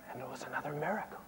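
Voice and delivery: hushed, quick whisper of wonder; awed whisper. Acted. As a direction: whisper it like a sacred secret. Awed reveal of the miracle.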